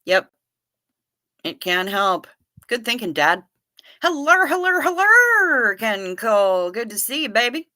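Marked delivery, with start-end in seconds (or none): 4.0-5.8 high, loud repeated hellos; sing-song hellos. Real, not acted. Streamer greets Ken Cole as he arrives in chat, high sing-song. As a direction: sing out a happy welcome.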